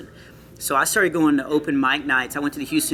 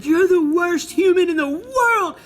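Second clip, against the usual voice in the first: high, slow, wailing mock of a crying girl; imitating crying girl; 4 dB louder, slower than usual. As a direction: whine it out like a sobbing teen. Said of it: "she just started crying".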